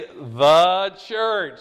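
slow, stretched THE, then a firm church; stress on THE. Real, not acted. Teacher giving the answer to underline, stress on THE. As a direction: set THE apart with heavy weight. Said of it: "So underline the church".

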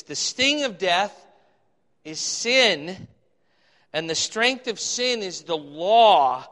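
loud, slow, declaimed Bible verse; scripture reading. Acted. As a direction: proclaim it with ringing emphasis. Reads scripture aloud.

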